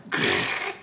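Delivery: breathy low growl; cat's eager growl. A narrator's reading. The cat growls as it runs to lap the milk. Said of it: "esclamò lei".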